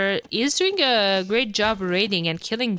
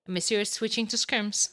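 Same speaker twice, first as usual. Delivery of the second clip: ordinary talk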